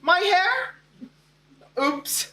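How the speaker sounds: high questioning teacher voice; puzzled teacher. Retold or imitated. Teacher calling attendance in class, puzzled. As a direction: puzzle over the name, pitched high. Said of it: "she paused and said".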